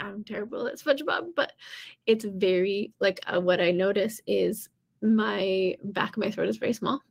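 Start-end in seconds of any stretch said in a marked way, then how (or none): none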